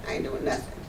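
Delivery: mock sullen kid, nearly whispered under the breath; imitating her younger self's sullen refusal, mumbled; sullen mumble. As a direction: mumble "I ain't doing nothing" unvoiced, dropping away at the end.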